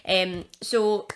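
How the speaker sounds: ordinary delivery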